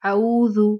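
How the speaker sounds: औजू said loud with no throat sound; औजू with the आइन lost entirely; wrong, no आइन. Shown as a demonstration. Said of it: "मतलब आइन का पता ही नहीं चले".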